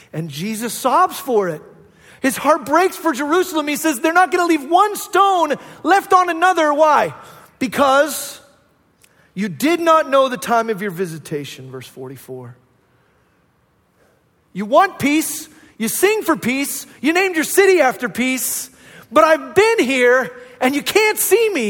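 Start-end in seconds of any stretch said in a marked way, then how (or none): 0.1-11.7 loud, high impassioned preaching; a preacher's rising passionate climax; full-force preaching. Acted. 14.5-21.7 loud, high impassioned preaching; a preacher's rising passionate climax; full-force preaching. Acted.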